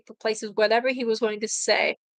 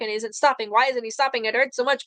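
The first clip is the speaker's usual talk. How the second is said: ordinary delivery, nothing marked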